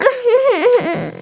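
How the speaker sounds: a soft sob with a little laugh in it; tearful sob. Acted. Girl showing her mom the broken tablet, soft sob. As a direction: let a quiet sob break out. Crying over broken screen.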